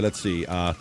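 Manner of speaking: ordinary talk, nothing marked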